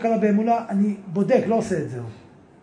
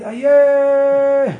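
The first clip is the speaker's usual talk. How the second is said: loud, very slow held call, pitch raised; long drawn-out cry; 4 semitones higher, 5 dB louder, much slower than usual. Acted. A penitent alone in the forest crying out to God, long loud cry. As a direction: cry out and hold the word long. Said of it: "סלע ולהגיד".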